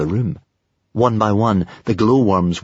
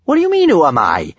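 high, loud, quick disbelief; surprised; 9 semitones higher, 5 dB louder, faster than usual. A narrator's reading. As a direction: fire back the question in astonishment. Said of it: "said Kamikaze in surprise".

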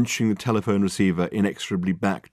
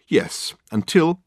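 dramatic drawn-out pause; 8 semitones higher, 3 dB louder, much slower than usual. A narrator's reading. Dirk's dramatic pause before today.

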